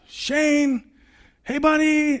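dad calling his hidden son, forced high breezy call; fake casual. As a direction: sound breezy while panicking. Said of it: "trying to act like this is no big deal".

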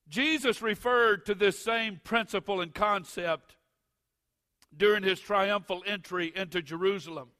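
loud, high, building preacher's cadence; preaching at full force. Acted.

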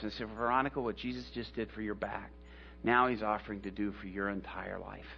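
tender appeal. Retold or imitated. Pastor quietly urges Bob and Veronica to accept Jesus, tender and soft. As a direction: coax them gently toward faith.

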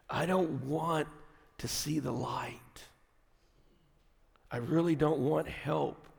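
soft, half-whispered resignation; despairing inner voice. Acted. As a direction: let it sink into hopeless quiet. Voicing the depressed inner thought.

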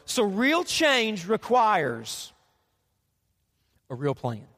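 slow emphasis. Shown as a demonstration. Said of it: "repeats key line slowly".

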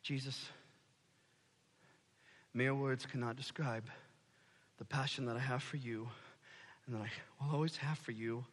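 low, soft and sincere; heartfelt confession. Acted. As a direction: pour out your love quietly. Peter's heartfelt confession to Jesus.

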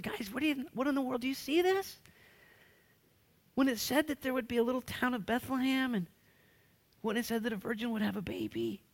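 fired-up Jesus. Retold or imitated. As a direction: burst with wonder at the prophecy coming true. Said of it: "Jesus is so fired up".

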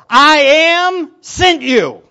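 loud, high, slow proclamation; divine command. Acted. God sending Moses to the people, proclaiming. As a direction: proclaim it slowly with weight on "I am". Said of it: "Tell the people".

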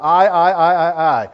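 I, I, I hammered out loud; repeating I to show Lucifer's pride; emphatic I. Shown as a demonstration. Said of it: "I'm emphasizing one key word there".

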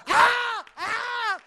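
high screaming cry; terrified yell. Retold or imitated. Preacher reliving Miss Ada grabbing him, screaming. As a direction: yell like she's got your face.

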